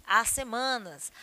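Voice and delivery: usual speaking voice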